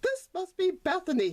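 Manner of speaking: high hopeful little voice; a small donkey sure he has arrived. Acted. Sonny thinks aloud in the market, high and hopeful. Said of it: "he thought".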